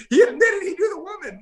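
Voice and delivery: laughing. Real, not acted. Telling the interviewers how the rookie confessed, laughing through it. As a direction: try to talk while cracking up. Laughing at own prank story.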